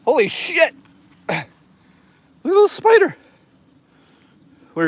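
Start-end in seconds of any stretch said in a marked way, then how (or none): 0.1-3.2 much higher and louder, delighted; rising squeal of delight at a bug; excited squeal. Real, not acted. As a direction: coo over the little spider. Spots a cute little spider.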